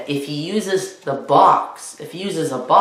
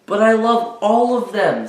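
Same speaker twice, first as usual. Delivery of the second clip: put-on higher boyish whine of protest; boy crying out; 6 semitones higher than usual. Spencer begs his mother, in a higher, whiny voice. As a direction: plead like a child who loves every toy. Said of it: "Spencer cried".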